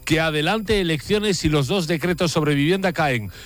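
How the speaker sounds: high-pitched, driving radio news delivery; radio anchor teeing up the latest news; live news link. Real, not acted. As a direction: read it like an urgent handover to a reporter. Presenter hands over to breaking news.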